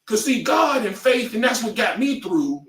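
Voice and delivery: high, shouted preacher-style Deion; hammed-up Deion testifying about faith; Deion Sanders impression. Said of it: "'Cause Deion always went".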